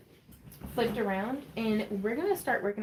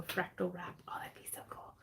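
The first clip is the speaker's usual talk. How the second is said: soft whispered musing; whispering; 3 semitones lower, 15 dB quieter than usual. Real, not acted. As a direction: whisper it to yourself. Whispered asides while crafting.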